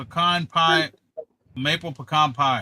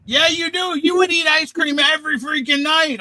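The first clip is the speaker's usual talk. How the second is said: excited teasing; 11 semitones higher, 3 dB louder than usual. Real, not acted. Teasing accusation, pitch jumps.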